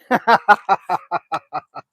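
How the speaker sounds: coughing it up with a laugh; rough laughing cough; just swallowed a fly. Real, not acted. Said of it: "That was kinda nasty".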